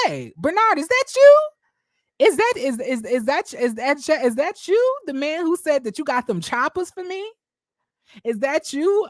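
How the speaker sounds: high, loud, thrilled greeting of a friend; excited call-out. Retold or imitated. Woman on a live video greets Bernard with an excited high shout.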